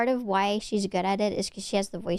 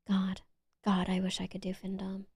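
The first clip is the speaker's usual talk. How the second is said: a much softer, unvoiced whisper; 2 semitones lower, 7 dB quieter than usual. Shown as a demonstration. Whispering her wish to do Findom to chat. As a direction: breathe it out as a secret wish. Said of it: "whispered repeat of her wish".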